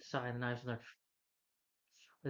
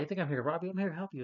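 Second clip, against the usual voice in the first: sheepish excuse; 5 semitones higher, faster than usual. Retold or imitated. A caught kid fibs to the turtle, higher and nervous. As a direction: talk your way out, caught red-handed. Retold monster kid's excuse.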